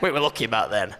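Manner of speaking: put-on old man voice. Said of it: "Do we need to sound older in our voices?".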